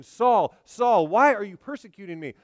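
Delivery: loud, ringing call from heaven. Retold or imitated. The voice from heaven calls Saul, loud and ringing. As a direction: call his name out twice, strong.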